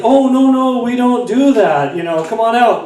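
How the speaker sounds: high-pitched mock parental reproach; put-on parent voice, high and coaxing; gently scolding parent. Dad telling his toddler off, mock gentle scolding.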